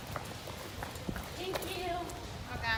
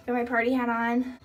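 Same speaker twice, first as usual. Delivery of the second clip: usual speaking voice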